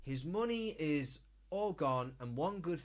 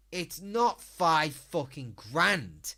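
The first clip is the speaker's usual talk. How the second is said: 1 emphatic rant; 4 semitones higher, 8 dB louder, slower than usual. Real, not acted. Ranting about the money spent.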